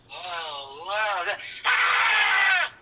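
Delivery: wow building to a high shout; mock background scream. Shown as a demonstration. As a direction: gasp in awe then yell. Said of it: "Okay, go".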